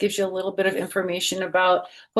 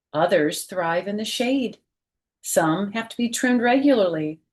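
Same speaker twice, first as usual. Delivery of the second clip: lively picture-book read-aloud voice; reading to children; 4 semitones higher than usual. Acted. Teacher reading a picture book to her class, lively read-aloud. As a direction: read it out like story time for little kids.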